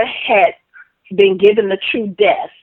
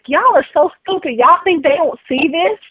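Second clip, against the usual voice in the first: incredulous scolding; 7 semitones higher than usual. Retold or imitated. Mock address to scheming characters.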